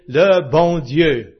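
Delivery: le bon Dieu said very slowly in French; French Canadian phrase. Shown as a demonstration. Quoting how French Canadians name God, said slowly in French. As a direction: spell out le bon Dieu, slow and careful. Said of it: "They always say".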